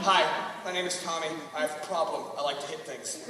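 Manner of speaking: low, hushed sheepish admission; mock-sombre addict introduction; support-group confession. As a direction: confess quietly to the group, fading away.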